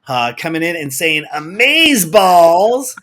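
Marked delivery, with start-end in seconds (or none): 1.3-3.0 loud, hugely stretched exclamation; hyped shout-out. Acted. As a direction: draw it out big and high like an announcer. Reads out a listener's enthusiastic comment.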